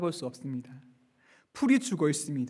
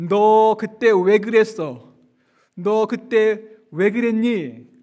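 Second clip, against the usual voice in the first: louder, slow demanding rebuke; accusing scold; 3 semitones higher, 7 dB louder, much slower than usual. Acted. Imagined Jesus scolding Peter, loud and slow. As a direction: drag out each word like a stern reproach. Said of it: "이렇게 따져 물을 것이라고".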